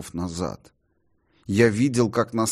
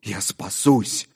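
exclamation; 2 semitones higher, slower than usual. A narrator's reading. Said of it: "вскричал я".

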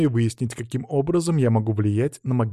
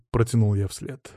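ordinary delivery, nothing marked

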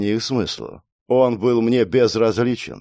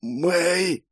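weak, higher, strained croak; croaked name; 7 semitones higher, 3 dB quieter than usual. Acted. Dying boy calls Mei, croaking. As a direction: croak it out with little strength. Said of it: "прохрипел я".

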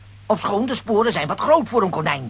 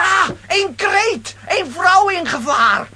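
high, slow, dramatic cry of alarm; heroic alarm; 11 semitones higher, 3 dB louder, much slower than usual. Acted. Lambiek hears a woman scream, dramatic heroic alarm. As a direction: call it out like a stage hero.

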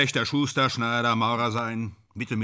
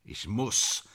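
resigned admission; 6 dB quieter than usual. Acted. Actor admitting he must play the role, subdued. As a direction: say it softly, almost to yourself.

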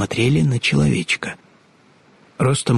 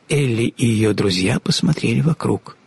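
ordinary delivery

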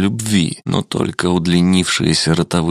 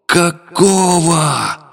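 much higher, slow yell; 11 semitones higher, much slower than usual. A narrator's reading. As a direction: bellow it in shock. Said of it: "проорал шиноби".

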